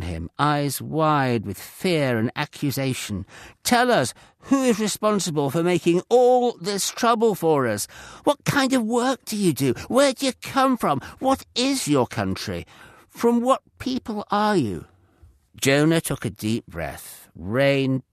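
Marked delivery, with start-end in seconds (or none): 3.6-14.9 accusing demand. A narrator's reading. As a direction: fire the questions at him, scared and accusing. Sailors accuse Jonah in fear.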